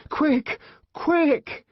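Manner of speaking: high, loud, panicked; the savage urging the nurse to hurry; urgent shout. A narrator's reading. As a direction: drag her along with the words. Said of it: "savage urging nurse".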